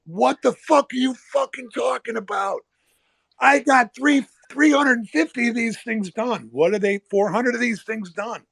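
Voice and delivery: high-pitched, indignant imitation of a furious trader; retold angry outburst. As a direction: pitch it up and fire back in mock outrage. Jimmy angrily confronts the broker.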